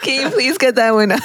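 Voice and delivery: pleading through laughter; laughing. Real, not acted. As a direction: giggle out the request. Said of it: "laughing while asking".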